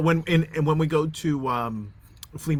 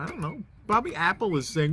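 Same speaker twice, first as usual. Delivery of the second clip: ordinary delivery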